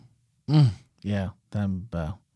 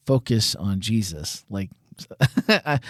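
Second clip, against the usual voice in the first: ordinary talk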